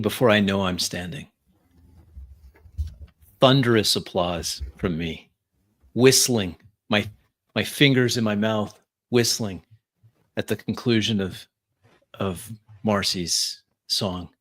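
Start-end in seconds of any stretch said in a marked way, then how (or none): none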